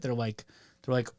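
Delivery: normal talking voice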